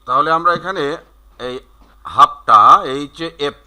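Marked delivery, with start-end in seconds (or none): 2.0-2.8 "হাফটা" said loudly as one word, "hafta"; shortcut form. Shown as a demonstration. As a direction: punch out the contraction "হাফটা". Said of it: "যদি shortcut বলি আমরা".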